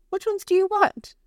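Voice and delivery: grandma voice. Retold or imitated.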